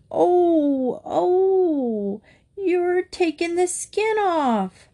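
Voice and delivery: put-on high, drawn-out wail of a little boy; long, high-pitched childish yowl; howling in protest. A narrator's reading. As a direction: howl as if being scrubbed raw. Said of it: "howled the brave little Mountie".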